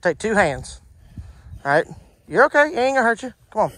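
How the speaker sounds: ordinary talk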